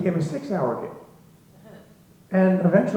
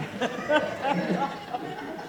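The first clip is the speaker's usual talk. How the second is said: breathy laugh; 7 semitones higher, 8 dB quieter than usual. Real, not acted. Laugh mid-story.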